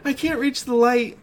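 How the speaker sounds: high, loud frightened voice; a small kid afraid of the dark; scared child. A narrator's reading. Child calling up to dad about the light.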